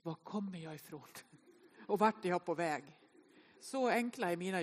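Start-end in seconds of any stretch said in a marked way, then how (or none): 0.0-3.0 hushed, lower musing to oneself; mock existential pondering. Walker asking where they come from, mock-profound quiet pondering. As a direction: drop to a soft, thoughtful murmur. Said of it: "en djupt existentiell fråga".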